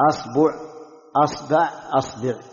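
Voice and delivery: أصبع said as asba', then asbu', then asbi'; hamza with fatha, three ba vowels. Shown as a demonstration. Teacher listing the fatha forms of the word for finger. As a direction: drill أصبع with an open a, changing the ba each time. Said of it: "خذوا فتح الهمزة وتثليث الباء".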